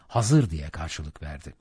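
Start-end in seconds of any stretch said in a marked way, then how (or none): none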